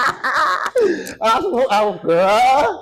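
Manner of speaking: high laughter; laughing out loud. Real, not acted. Both laughing at the question.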